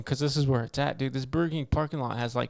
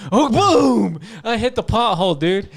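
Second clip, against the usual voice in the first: Oh boom shouted, much higher and louder; sudden excited shout telling the crash; loud retold crash; 8 semitones higher, 9 dB louder, slower than usual. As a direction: burst out with the impact of the hole. Hitting a pothole retold.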